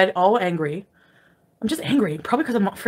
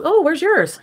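higher-pitched acted question; mock curiosity; 7 semitones higher, slower than usual. Retelling the reply about channels, mock curiosity. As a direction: lift it into a put-on innocent query.

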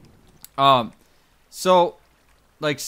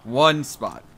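ordinary talk

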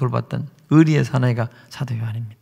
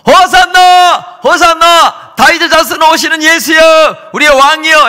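crowd shouting hosanna; 12 semitones higher, 9 dB louder, slower than usual. Acted. Crowd hailing Jesus entering Jerusalem.

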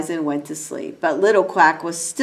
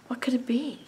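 soft mysterious whisper; 7 semitones higher, 8 dB quieter, faster than usual. Acted. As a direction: drop to a hushed question. Ducklings wonder in dark.

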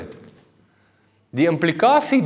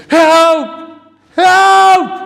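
high, loud, drawn-out yell; screamed cry for help; 11 dB louder than usual. Retold or imitated. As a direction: scream for your life from the sea. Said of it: "skree ek uit".

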